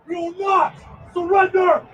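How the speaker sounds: ordinary delivery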